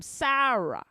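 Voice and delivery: loud, broad New York vowel; hammed-up New York pronunciation; New York accent. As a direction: exaggerate it like a New Yorker. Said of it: "when I talk to somebody in New York".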